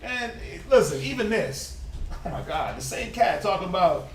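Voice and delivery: measured reading of Esther; scripture reading. Acted. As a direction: read the verse steadily.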